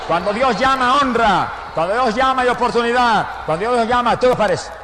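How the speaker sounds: loud, rising pulpit preaching; preaching at full force. Real, not acted. Preacher to the congregation, full-force preaching. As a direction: drive each phrase home to the church.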